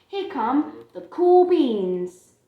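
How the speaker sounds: slow, loud showman intro; slow drawn-out fanfare; grand announcement. Acted. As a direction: announce them like celebrities. Grand announcement of cool beans.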